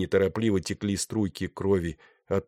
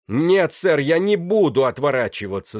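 high, loud cry cracked with horror; hysterical shout; 7 semitones higher, 7 dB louder than usual. A narrator's reading. Defiant captain to his executioner, shouted hysterically. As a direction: shout it out, broken by terror. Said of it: "закричал он наконец".

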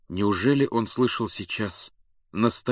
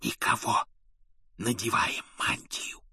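hushed, unvoiced whisper; 6 dB quieter than usual. A narrator's reading. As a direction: keep it barely audible, hiding. Hiding, sneaking out.

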